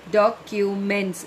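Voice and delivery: Documents slowly split as do-cu-ments; three syllables. Shown as a demonstration. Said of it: "is three syllables".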